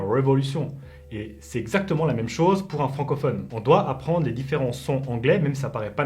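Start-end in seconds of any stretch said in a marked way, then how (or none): 0.0-0.7 "révolution" with an English R; an English speaker attempting French "révolution"; English accent. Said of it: "comme un anglais qui aimerait prononcer".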